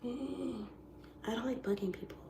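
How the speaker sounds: small, soft, reluctant voice; softly voiced reluctance; reluctant reply. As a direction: back away from it quietly. Her reluctant reply to coaches.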